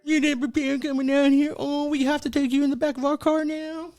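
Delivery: high, mocking cop voice talking down to him. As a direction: sneer like a cop scolding a scared guy. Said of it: "Even the cops would lose respect for you".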